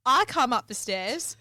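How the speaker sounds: ordinary talk, nothing marked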